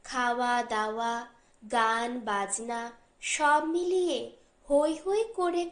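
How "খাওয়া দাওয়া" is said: usual speaking voice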